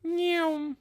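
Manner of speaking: high goofy nyeom noise; silly nyeom noise. Pretend. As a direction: pop out a high nonsense sound. Silly noise while switching screens.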